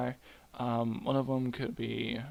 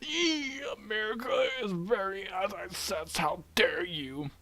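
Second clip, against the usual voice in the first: high, squealing mock outrage; hammed-up high-pitched indignation; mock scandalized objector; 9 semitones higher than usual. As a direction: shriek it like an offended prude. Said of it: "but you're like".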